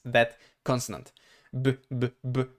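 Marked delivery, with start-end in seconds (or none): 1.5-2.4 "B" as a short voiced buzz from the throat; voiced b sound. Shown as a demonstration. Learner testing B for throat vibration, voiced sound. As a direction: repeat "b" as isolated voiced bursts. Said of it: "I can feel the vibration in my throat".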